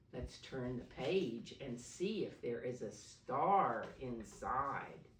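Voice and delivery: usual speaking voice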